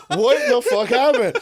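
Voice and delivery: very high, loud, slow laughing shock; shocked disbelief. Retold or imitated. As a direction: squeal it in amazed disbelief. Therapist shocked at his progress.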